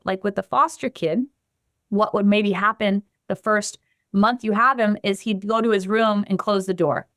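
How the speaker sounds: usual speaking voice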